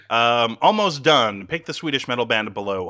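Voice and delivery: normal talking voice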